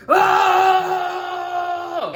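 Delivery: high, loud anguished cry; mock anguished shout. Retelling Steve's reaction to Brady, hammed-up cry. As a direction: let out a big pained yell. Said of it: "Here goes Steve".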